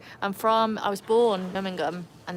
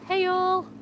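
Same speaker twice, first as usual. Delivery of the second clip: high, cheery arrival call; excited greeting; 11 semitones higher, 5 dB louder than usual. Real, not acted. Streamer welcomes another regular to chat, high excited greeting. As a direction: sing out the welcome, pitched up.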